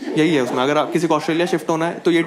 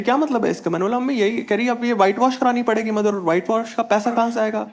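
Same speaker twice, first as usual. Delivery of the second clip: lightly voiced scolding mother, higher; a hint of a fussing mom, pitched up; mom's voice; 7 semitones higher than usual. Mom scolding son over marker on the wall, higher voice. Mom arguing about whitewash.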